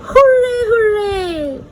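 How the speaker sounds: high excited hooray; squealing hooray; cheering. Acted. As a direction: cheer it out like a happy child. Cheering in the ABC book.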